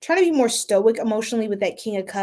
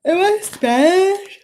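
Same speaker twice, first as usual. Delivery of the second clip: playful high voice; 9 semitones higher, 6 dB louder, slower than usual. Pretend. Playful question in put-on voice.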